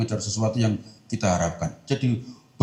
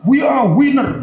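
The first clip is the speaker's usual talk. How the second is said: high, loud triumphant yell; mock victory shout; 14 semitones higher, 9 dB louder, slower than usual. Lone racer shouting his victory, hammed up. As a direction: crow like a lone champion.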